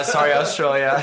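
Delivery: normal talking voice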